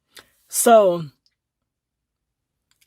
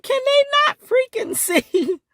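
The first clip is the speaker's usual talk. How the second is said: laughing disbelief. Retold or imitated. Her incredulous retort.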